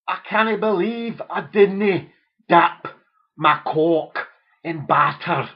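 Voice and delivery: loud, slow Scots groan; hammed-up Scottish regret; mock Scottish lament. Son laments to his father in mock Scottish. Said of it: "that's how they speak up in Scotland".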